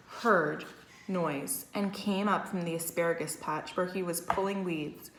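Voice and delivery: ordinary delivery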